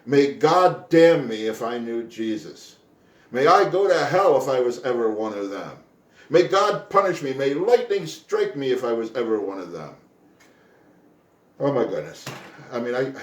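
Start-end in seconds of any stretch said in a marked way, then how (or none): 0.1-10.0 Peter's oath. Acted. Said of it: "Peter is saying here".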